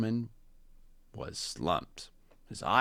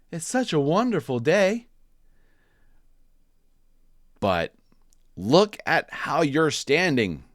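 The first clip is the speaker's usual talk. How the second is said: high, loud and bright-eyed; a chirpy bird cheering Jim up; cheerful bird voice; 9 semitones higher, 8 dB louder than usual. Acted. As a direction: reader voicing Marabou, sing-song. Marabou voiced in read-aloud story.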